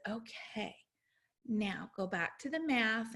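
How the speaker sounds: soft, gentle coaxing; calm reassurance. Retold or imitated. Mother to son after his breather, calm and soft. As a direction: ease him back in softly.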